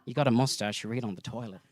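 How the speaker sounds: low, quiet mock-manly voice; manly podcast host. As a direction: drop it low like a macho podcaster. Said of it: "you say manly things like".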